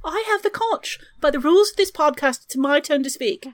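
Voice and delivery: prim, high British schoolboy voice; British schoolboy accent. Pretend. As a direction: play a pompous English schoolboy claiming the floor. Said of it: "why are you speaking like a British schoolboy?".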